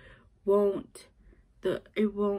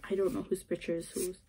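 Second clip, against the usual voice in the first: ordinary delivery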